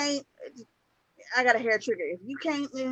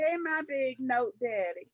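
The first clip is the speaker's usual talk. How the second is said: slow reread; 6 dB quieter, slower than usual. Shown as a demonstration. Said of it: "Hold on, wait a minute".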